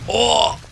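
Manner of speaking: high-pitched cry of shock; sudden amazed whoop; startled "oo". Real, not acted. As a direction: let out a long surprised "oo". Unexpected explosion in game.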